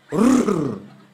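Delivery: loud rolled growl imitating scratching. Father scratching the bedroom window, loud rolled rrrr. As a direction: rattle it like claws on glass.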